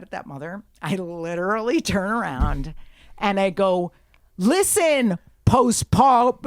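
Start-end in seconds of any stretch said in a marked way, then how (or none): 4.4-6.4 loud, high scolding; angry confrontation. Retold or imitated. Passenger turning round on the mother, angry and loud. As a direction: retell it as a loud, angry call-out.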